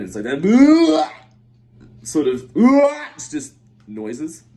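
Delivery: loud, high, drawn-out retches between words; imitated dry gagging. Shown as a demonstration. Storyteller shows friend's empty gagging, imitated dry retch. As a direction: act out gagging with nothing left. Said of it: "when there's nothing left, but you're still gagging".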